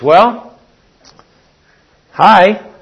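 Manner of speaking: cheerful wife greeting. Retold or imitated. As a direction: greet him, hoping he'll ask. Wife greets husband in garage.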